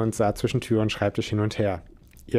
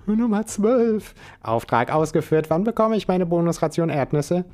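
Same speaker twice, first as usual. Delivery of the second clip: a hint of a cheeky squirrel; squirrel Spike reporting; 7 semitones higher than usual. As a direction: report like a little agent robot.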